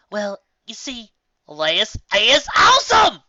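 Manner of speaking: high, slow, loud cheer; excited. Acted. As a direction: gush with delight. Excited praise.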